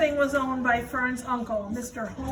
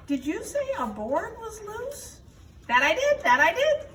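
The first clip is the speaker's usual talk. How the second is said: higher, lively animal voices; character voices; 8 semitones higher than usual. Acted. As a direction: play the goose and the pig. Wilbur and goose dialogue.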